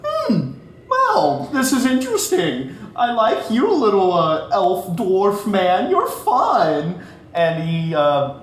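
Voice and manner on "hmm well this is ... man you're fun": high, amused villain voice; sing-song pitched-up trickster; quirky villain. Acted. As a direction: toy with the little man, delighted. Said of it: "He's just gonna".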